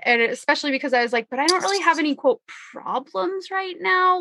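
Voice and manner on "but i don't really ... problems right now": mock past self. Quoting own past self.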